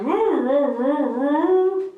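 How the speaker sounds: playful dog-bark impression; imitating dog's bark. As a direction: bark like a dog in long, swooping roos. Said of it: "His bark is like".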